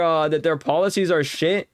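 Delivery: ordinary delivery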